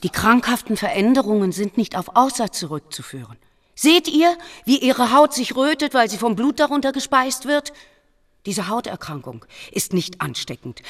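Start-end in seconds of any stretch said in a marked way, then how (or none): 0.0-7.7 higher, louder, eager demonstration; excited plea to see the evidence; urgent insistence. Acted. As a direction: press the point with urgency. Monk urgently argues before abbot.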